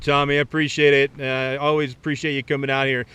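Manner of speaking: ordinary talk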